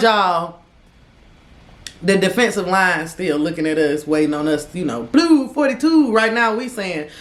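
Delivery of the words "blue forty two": higher, louder play call; mock quarterback call. Teller joking they are a football team at the line, mock play call.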